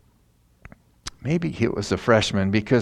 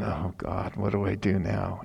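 much softer worried plea to God; anxious prayer; 3 semitones lower, 8 dB quieter than usual. Retold or imitated. Pastor facing the drunk man, praying softly in worry. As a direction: murmur a desperate little prayer. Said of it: "And I'm going".

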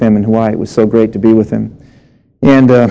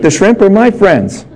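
teasing punchline; 7 semitones higher than usual. Acted. Teasing punchline about shrimp.

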